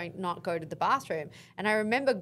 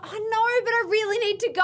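higher, louder pleading plea; desperate boyfriend; 12 semitones higher, 5 dB louder than usual. Retold or imitated. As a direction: beg urgently to be let in.

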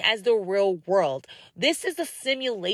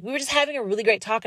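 usual speaking voice

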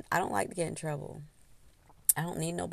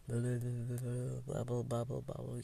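bubbling mutter; 6 semitones lower, 11 dB quieter than usual. Shown as a demonstration. Imitating stewing, bubbling.

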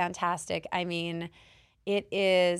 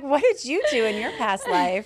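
excited; 7 semitones higher, 7 dB louder than usual. Retold or imitated. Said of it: "I'm like".

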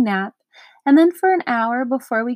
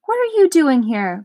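usual speaking voice

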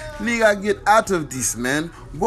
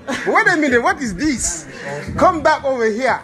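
ordinary delivery

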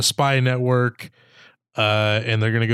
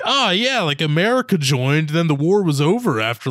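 higher, singsong mock-naive voice; 4 semitones higher than usual. Host mocking a middle school take on World War One, mock naive. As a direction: play a breezy know-it-all schoolkid.

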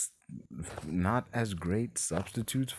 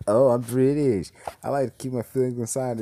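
mock British; 4 semitones higher, 6 dB louder than usual. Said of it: "over the fact that he's British".

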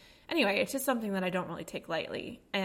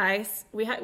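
normal talking voice

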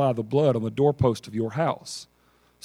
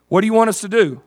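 eager Joshua; 6 semitones higher, 5 dB louder, faster than usual. Acted. Joshua asks commander.

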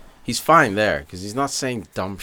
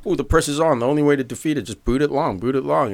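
higher-pitched, lightly hammed-up alarm; mock panicking commentator; 6 semitones higher than usual. Old-school commentator watching a risky back pass, mock panicking. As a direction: imitate a commentator fretting over the press. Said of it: "you always hear the commentators panicking".

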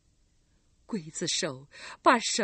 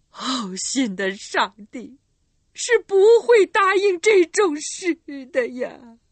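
slow then high and loud; desperate cry to God; 6 semitones higher, 11 dB louder than usual. Acted. Mother crying to God, desperate and loud. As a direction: plead to heaven in despair.

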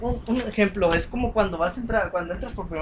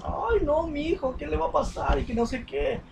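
imitación muy aguda de madre primeriza; mamá primeriza angustiada; 7 semitones higher than usual. Retold or imitated. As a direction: chillido de madre nerviosa por su hijo. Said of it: "las mamás primerizas". (mamá primeriza preocupada por su hijo, voz muy aguda)

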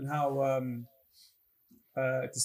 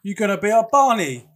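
much higher and louder, hammed-up Cockney accent; mock Cockney accent; 7 semitones higher, 9 dB louder than usual. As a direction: ham up a bad movie Cockney accent. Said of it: "Don Cheadle's accent".

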